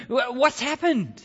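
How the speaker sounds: high, drawn-out alarmed question; alarmed wife. Acted. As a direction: stammer it in high, startled alarm. Wife finds husband weeping on knees.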